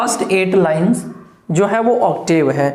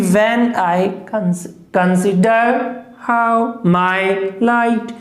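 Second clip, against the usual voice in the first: when I consider how my light in very slow beats; iambic reading; much slower than usual. Shown as a demonstration. Teacher scanning Milton's line aloud for class. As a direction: scan the line slowly, beat by beat. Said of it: "clear है".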